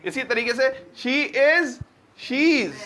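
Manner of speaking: normal talking voice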